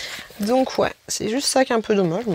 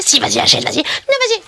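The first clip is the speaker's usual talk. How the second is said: little devil within; 8 semitones higher than usual. Acted. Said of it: "le petit dia qui était en moi".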